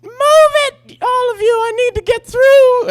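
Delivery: high, loud, panicked yelling; frantic shout. Retold or imitated. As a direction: shove through the crowd, screaming. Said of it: "and she said".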